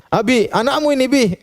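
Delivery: high, whiny put-upon wife voice; mock wife complaining. Wife complains to husband about their child, high and whiny. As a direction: hand the child off to dad, teasing.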